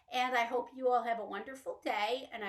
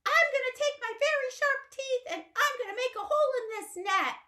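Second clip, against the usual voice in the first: very high, loud, fast little mouse; squeaky mouse voice; 13 semitones higher, 4 dB louder than usual. Acted. Little Mouse running to rescue Lion, high and quick. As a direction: chirp it out like an excited tiny mouse.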